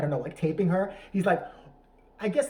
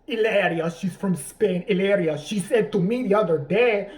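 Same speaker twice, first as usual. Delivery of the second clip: mock a husband faking a loud Spanish accent; broad fake Spanish accent, drawn out at the end; bad Mexican accent; 5 dB louder, slower than usual. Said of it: "he starts talking like that".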